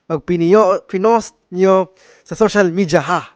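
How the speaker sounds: put-on loud, hammy Filipino-accented MC voice; a tea house owner hosting in a thick Filipino accent. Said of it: "Faking a Filipino accent".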